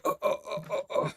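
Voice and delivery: weak, breathy gasps of pain; soft acted moans, one after another; pained groans. Beaten Norman groaning after punches, pained.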